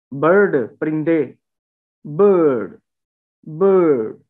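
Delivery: बर्ड with a long uh and a silent r; no-r bird. Shown as a demonstration. Teacher drills bird with a long schwa. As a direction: drop the r in बर्ड. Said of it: "इसमें आर कोई नहीं बोला जा रहा".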